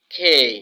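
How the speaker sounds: "খেই" with a strong puff after k; loud "খেই", starting like খ; aspirated K. Shown as a demonstration. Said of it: "খ এর মতো উচ্চারণ হবে".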